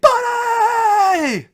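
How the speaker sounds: squealing high-pitched yell of joy; excited shout. Real, not acted. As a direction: scream the greeting with delight. Excited greeting of returning guest.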